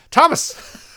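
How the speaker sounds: high, loud Irish nan call; nan's Irish accent. Retold or imitated. Irish nan calling her grandson, loud Irish accent. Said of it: "I'm gonna do the accent".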